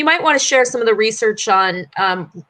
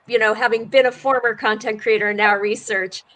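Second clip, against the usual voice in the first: usual speaking voice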